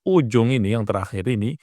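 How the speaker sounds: normal talking voice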